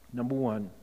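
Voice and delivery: ordinary delivery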